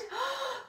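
sharp startled intake of breath; sudden gasp. Acted. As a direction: gasp as the trap springs. Lion suddenly trapped in story.

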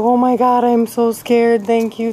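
ordinary talk, nothing marked

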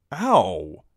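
loud sudden ow; pained yelp. Acted. Gendry hit by a crab apple, loud yelp. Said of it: "he said".

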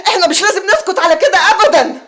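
loud, high, fired-up protest; worked up and defiant; indignant vow. Acted. Rallying co-wife against the nurse.

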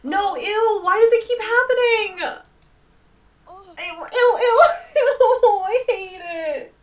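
very high, wailing revulsion; squealing disgust. Real, not acted. Player asking why bugs keep attacking, high disgusted wail. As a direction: whine and squeal, nearly laughing. Bug attack happens yet again.

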